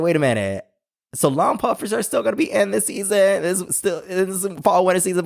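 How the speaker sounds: high-pitched, incredulous question; excited double take; excited surprise. Retold or imitated. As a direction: pitch it up as if just spotting good news. Quoting own delighted realization about puffers.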